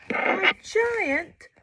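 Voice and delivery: high, loud cry; dramatic alarm. A narrator's reading. Narrator crying out the asteroid, high and loud. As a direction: cry out the danger.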